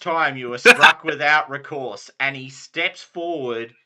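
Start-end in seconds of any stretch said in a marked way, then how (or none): 0.0-2.1 loud stern knight, laughing through; Sir Brastius. Acted. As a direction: boom it out as the lord. Lord slaps the squires.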